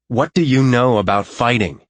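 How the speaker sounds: rising anger, defensive; angry retort. A narrator's reading. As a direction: throw the question back in anger. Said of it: "Anger makes me see red".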